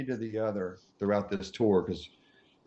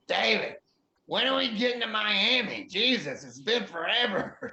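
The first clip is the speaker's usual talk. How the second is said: higher, whiny impatient rock star; whiny Steven; 9 semitones higher than usual. Retold or imitated. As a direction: complain like a restless passenger, higher. Voicing Steven on plane.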